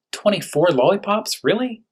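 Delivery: squeaky high disbelief; sky-high gasp of disbelief; mock astonishment. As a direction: act amazed at the number. Astonished at candy amount.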